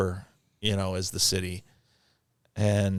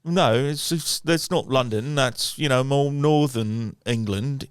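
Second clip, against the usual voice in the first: imitating an English friend, pitched up; English accent; 5 semitones higher, slower than usual. Host recalls Mike explaining northern England, imitated English accent. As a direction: put on an English accent, correcting someone. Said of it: "Mike used to say".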